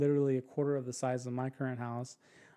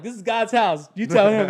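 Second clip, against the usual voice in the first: loud, higher retort with a laugh; laughing comeback; 6 semitones higher, 12 dB louder than usual. Retold or imitated. To a guest finding faults, a loud laughing comeback. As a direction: fire back at the guest, half laughing.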